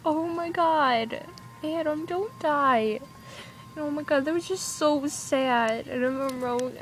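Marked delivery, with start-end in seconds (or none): 0.1-3.0 higher, slow, drawn-out plea; lightly acted fan distress, stretching the words; mock pleading. Viewer begging a shot TV character, mock pleading.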